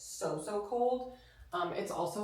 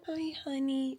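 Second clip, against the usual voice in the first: pet talk; 7 semitones higher, 4 dB quieter than usual. Real, not acted. Cooing greeting to the dog.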